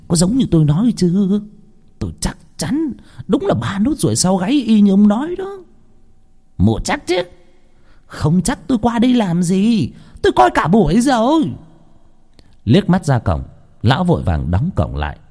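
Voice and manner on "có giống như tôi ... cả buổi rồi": high-pitched, eager character voices; excited confirming. A narrator's reading. Plotters checking they found the right boy, excited. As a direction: push the voice high and insistent.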